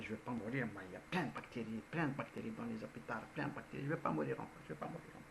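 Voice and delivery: quiet fussing queen muttering; muttered to herself. Retold or imitated. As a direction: grumble under your breath. Queen muttering about germs.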